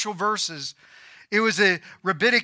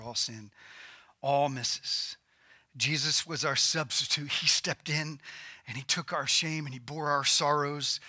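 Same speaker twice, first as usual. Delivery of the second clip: low, quiet, solemn sermon voice; hushed preaching; 4 semitones lower, 7 dB quieter than usual. Acted. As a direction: bring the sermon right down, soft and grave.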